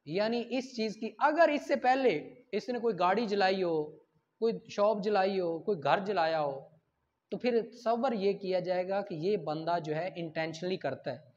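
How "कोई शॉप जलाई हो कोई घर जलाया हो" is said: ordinary talk, nothing marked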